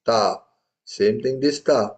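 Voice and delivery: ordinary delivery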